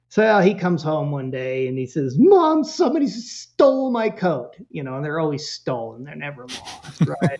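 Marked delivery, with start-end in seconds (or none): 2.2-4.5 put-on higher-pitched kid's grievance; complaining kid. Son tells Mom of stolen coat, complaining. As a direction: imitate a kid protesting to Mom.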